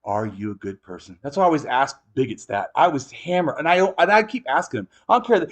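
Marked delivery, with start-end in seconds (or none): none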